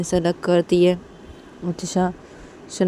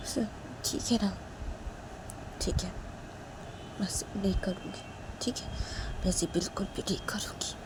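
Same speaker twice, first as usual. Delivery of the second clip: very soft, whispery reassurance; hushed, breathy tenderness; soothing, loving Disha; 4 semitones higher, 10 dB quieter than usual. Acted. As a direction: murmur a promise intimately.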